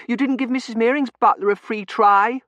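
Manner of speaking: put-on high, loud, indignant child; piercing childish protest; protesting little girl. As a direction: complain like a thwarted child.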